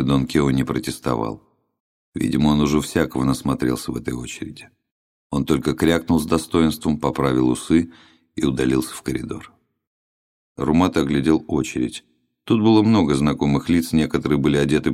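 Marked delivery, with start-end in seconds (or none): none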